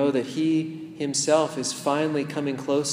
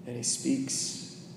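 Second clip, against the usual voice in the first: much softer, hushed guidance; intimate meditation hush; 2 semitones lower, 12 dB quieter than usual. Acted. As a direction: draw the listener into stillness with Jesus. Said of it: "leads a meditation".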